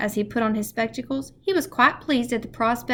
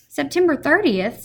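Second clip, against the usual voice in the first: high, louder sudden excitement; excited; 7 semitones higher, 6 dB louder than usual. A narrator's reading. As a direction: realise the date with a jolt. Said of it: "said Mister Popper in an excited tone".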